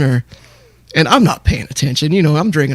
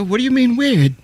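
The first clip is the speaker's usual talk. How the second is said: higher, put-on British accent; 7 semitones higher than usual. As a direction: slip into his British accent. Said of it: "I've taken his accent at this point".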